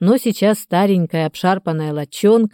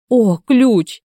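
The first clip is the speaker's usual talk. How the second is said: higher-pitched delighted "oh"; joyful exclamation; 5 semitones higher, 3 dB louder than usual. A narrator's reading. Trapped man hearing of a key, joyful exclamation. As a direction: exclaim with sudden glad relief. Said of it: "радостно воскликнул Дмитрий".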